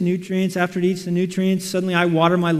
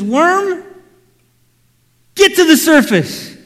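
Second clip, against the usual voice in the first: commanding God; 8 semitones higher, 7 dB louder, slower than usual. Acted.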